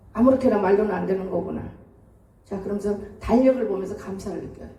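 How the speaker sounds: normal talking voice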